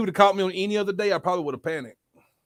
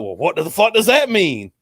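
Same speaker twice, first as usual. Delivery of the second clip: louder, indignant mock-cop demand; retold confrontational question; cop with attitude; 2 semitones higher, 5 dB louder than usual. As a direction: imitate a cop taking offense. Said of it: "come up with an attitude".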